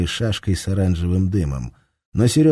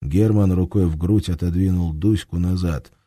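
plain reading voice

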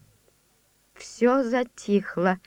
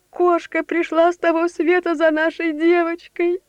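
put-on old servant voice; 6 semitones higher than usual. Superstitious servant's dread.

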